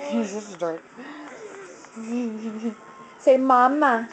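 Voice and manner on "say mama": normal talking voice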